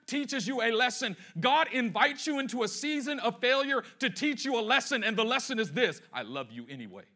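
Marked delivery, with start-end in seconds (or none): 6.1-7.0 quiet, lowered voice of unconditional love; tender reassurance. Acted. God giving the lesson to a failing believer, tender reassurance. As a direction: drop low and soft.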